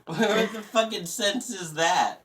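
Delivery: slow, loud, high words through laughter; laughing, drawn-out protest; laughing exasperation. Real, not acted. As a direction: exclaim in amused disbelief. Laughing outburst after mock job request.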